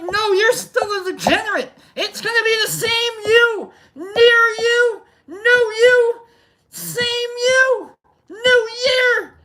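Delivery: very high, squealing falsetto mockery; hammed-up brain taunt, words drawn high; taunting inner voice. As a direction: jeer at yourself in a piercing squeaky voice. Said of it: "my body's like".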